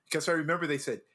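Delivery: ordinary talk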